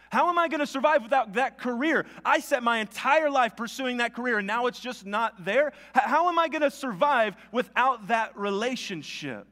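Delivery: frantic imagined prayer, hammed up; mock panic. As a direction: pour the worry out to God in a rush. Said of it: "the panic starts to set in".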